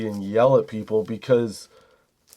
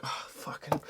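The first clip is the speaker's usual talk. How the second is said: exasperated mutter; 2 semitones higher, 14 dB quieter than usual. Retold or imitated. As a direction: curse under the breath, barely voiced.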